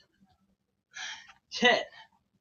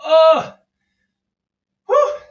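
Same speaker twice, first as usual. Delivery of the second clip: relief gasp; 8 semitones higher, 10 dB louder than usual. Real, not acted. Relief after finishing reps.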